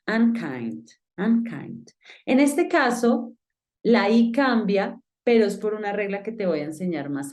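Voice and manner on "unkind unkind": "unkind" said "an-kaind"; U sounded as A. Shown as a demonstration. Teacher joining both parts, "unkind" as an-kaind. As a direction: open A starting "unkind", then "kaind". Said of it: "suena como una A".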